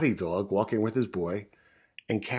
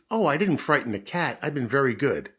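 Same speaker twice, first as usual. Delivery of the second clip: higher, louder little-puppy protest; 5 semitones higher, 6 dB louder than usual. Acted. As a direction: plead your good behaviour eagerly. Puppy defends his behaviour to mother.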